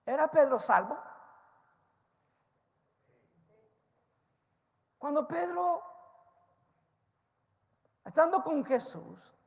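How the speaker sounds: loud, fast, high sermon delivery; full-force preaching. Acted. Preacher retelling Peter's story to the congregation, loud and fast. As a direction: drive the story home at the top of your voice.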